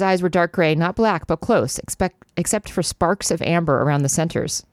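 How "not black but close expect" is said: ordinary talk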